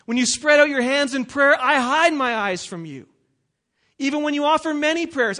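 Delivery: loud, high, declaiming the Lord's words; forceful scripture reading. Acted. Preacher reading God's rebuke to the congregation, loud and declaiming. Reads Isaiah at full force.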